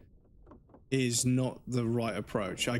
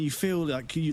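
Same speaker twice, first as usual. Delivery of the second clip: normal talking voice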